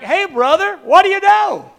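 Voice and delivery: very loud, high-pitched hail; hearty called-out greeting. Acted. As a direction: holler a hello to a buddy. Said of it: "I said something like".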